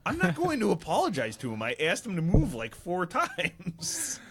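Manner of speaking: high, loud mock protest; hammed-up exasperation breaking into a laugh; retold indignant refusal. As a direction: act out outraged refusal, stretching the end.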